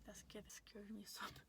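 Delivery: hushed, breathless whisper. Real, not acted. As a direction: whisper it as if still spooked. Quiet confession after the scary memory.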